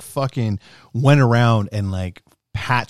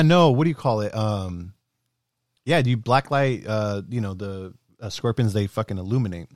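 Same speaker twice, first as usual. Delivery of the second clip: usual speaking voice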